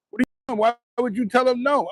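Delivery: high-pitched mock alarm; agitated caller, imitated. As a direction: imitate a caller jumping in, upset. Retold panicked caller jumping back on line.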